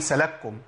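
"سلككم" run together as salakkum; wrong "سلككم" with the kafs merged. Shown as a demonstration. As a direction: drop the vowel of the first kaf in "سلككم", making one doubled k. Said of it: "سكنت الكاف وأضغمتها".

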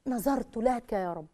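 slow, soft devout phrase; slow soft vow to God; devout recitation. Shown as a demonstration. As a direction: breathe it out slowly as a vow. Repeating the verse, devout.

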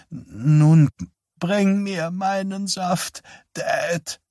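put-on high, slow voice of a confused old woman calling out; hoarse old mother calling. Confused mother calls to her dead husband for juice, high and hoarse. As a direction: call out hoarsely to someone not there. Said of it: "rief seine Mutter heiser".

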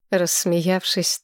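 usual speaking voice

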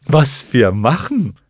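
loud, slow creature voice; clown moth announcing. A narrator's reading. First moth passes the question to its companions, announcing. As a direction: relay it loudly in a silly drawl. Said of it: "wieder an ihre Genossen".

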